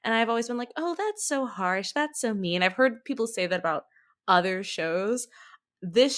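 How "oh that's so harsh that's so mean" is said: normal talking voice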